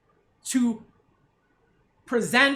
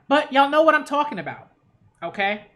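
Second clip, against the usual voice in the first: ordinary talk, nothing marked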